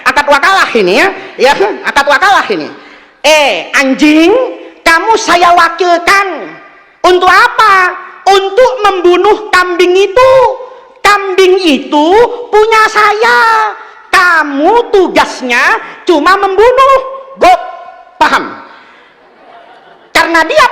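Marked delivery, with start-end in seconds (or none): 3.2-17.0 slow, high, raised-voice order; mock solemn command. Master appoints his dog to kill the goat, slow mock command. 17.4-17.6 one loud mock woof; imitated dog bark. Dog answers its master's order, imitated bark. Dog barks back in reply.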